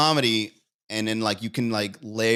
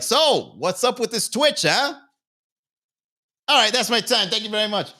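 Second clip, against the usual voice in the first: a much higher, louder mock of a cheesy club comic; hack comedian; 8 semitones higher, 3 dB louder than usual. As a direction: ham up the tired stand-up act and the sign-off. Mocks a stale stand-up comedian.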